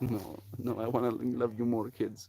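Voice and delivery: soft mock pleading; imagined family exchange. Parent to imagined kids, soft mock pleading. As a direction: plead quietly, half in play. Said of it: "This is what my kids are gonna be like".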